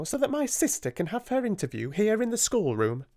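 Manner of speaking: put-on high, fluttery older lady voice; a nervous mistress hurrying the girls along, pitched high; fussy schoolmistress. A narrator's reading. Miss Amelia, fussy schoolmistress, voiced.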